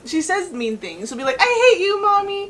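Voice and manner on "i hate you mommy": put-on high, loud whiny little-kid tantrum; raised, whiny kid voice; child's mad outburst. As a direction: imitate a small child throwing a fit. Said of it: "she starts getting really mad".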